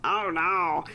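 mock weary dismay in an altered voice; Debbie. Mother on the couch hearing the complaint, mock dismay. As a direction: groan out a put-on dismayed reaction.

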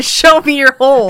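loud, high laughing echo of the line; loud, high indignant repeat; laughing denial quote. Retold or imitated. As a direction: throw it back at him in outraged disbelief. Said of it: "I've never once said".

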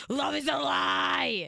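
slow, loud, heartbroken wail; dramatic despair. Retold or imitated. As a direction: cry it out like love betrayed you. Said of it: "and you're like".

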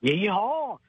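loud cowboy whoop; yeehaw. Acted. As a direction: holler it back. Caller answers the rodeo call.

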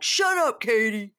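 high-pitched, imitated snap; loud mock shut-down; mock angry retort. Retelling Sandoval telling Katie off, mock angry.

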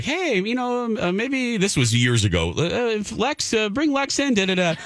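high, put-on voice for the staff suggesting she come in; imitating the clinic staff. Staff suggesting Doctor Beth, in a high put-on voice. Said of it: "and they were like".

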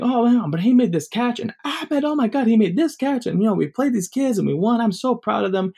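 high, excited uncle voice; proud uncle. Retold or imitated. Uncle bragging to family.

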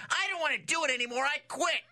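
high, fast mock outburst; hammed-up, high-pitched tantrum; acted quitting rant. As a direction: act out a star quitting in a huff. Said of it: "and then you can go".

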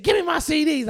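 high-pitched yelled demand; mock shrieked demand. Storyteller voicing girlfriend wanting her CDs back, high mock yell.

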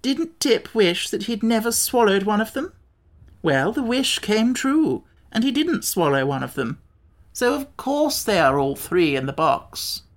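deliberate, emphatic explaining; lightly voiced Wogglebug, logical. Wogglebug explains the wish came true, lightly voiced, deliberate. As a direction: reason it out pointedly, as if obvious.

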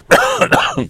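single real chesty cough; a short real cough mid-talk. Real, not acted. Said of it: "scusate la tosse".

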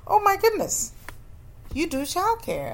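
a thrilled stranger, way up in pitch; thrilled lady. Retold or imitated. Pregnant lady thrilled to find her.

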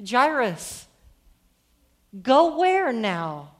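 drawn-out, imploring call; crying out. Acted. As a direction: appeal urgently to someone turning away. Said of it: "kind of cries into the text saying".

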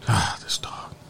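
hushed exasperated whisper; soft whispered grumble; whispered aside. Real, not acted. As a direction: breathe it under your breath. Whispered aside about dog.

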